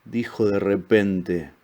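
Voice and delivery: usual speaking voice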